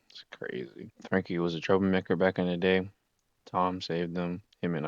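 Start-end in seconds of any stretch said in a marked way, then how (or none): 0.1-0.9 whisper. Real, not acted. Aside to the viewer.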